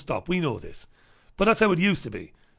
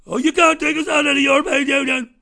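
high, louder, whining mock voice; imitating a whiny young voter, high and louder; mocking whiny youth; 11 semitones higher, 7 dB louder than usual. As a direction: sneer at them like a spoilt kid.

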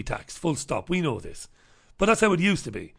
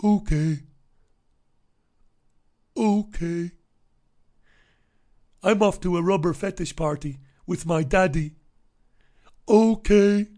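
lightly voiced, put-on mock politician voice; mock the Taoiseach as a pushover; mimicking Leo Varadkar giving in to the Germans; 3 semitones higher than usual. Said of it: "Leo Varadkar says".

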